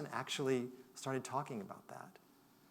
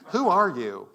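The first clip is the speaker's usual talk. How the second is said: 1 startled retort; 5 semitones higher, 13 dB louder, slower than usual. Retold or imitated. Man's startled reply retold.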